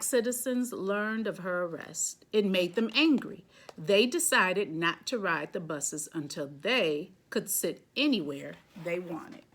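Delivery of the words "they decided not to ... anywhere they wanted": ordinary delivery, nothing marked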